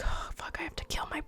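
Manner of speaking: very soft horrified whisper; whispered dread. Retold or imitated. Feyre realising who she must kill, whispered dread.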